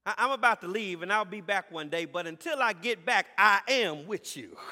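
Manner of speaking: loud voicing of Jesus' farewell; preacher rising as Jesus; preaching. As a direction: speak Jesus' promise with force.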